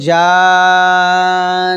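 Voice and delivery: slow nasal hold on জান; জান with drawn-out nasal ending; held nasal ghunna. Shown as a demonstration. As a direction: hum the n of জান long. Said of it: "শুধু গুণাটুকু আদায় করছি".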